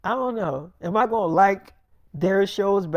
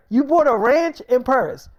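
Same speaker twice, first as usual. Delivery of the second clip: higher, louder incredulous question; stunned question; 4 semitones higher, 5 dB louder than usual. Retold or imitated. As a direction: repeat it back in disbelief. Partner stunned by ranch.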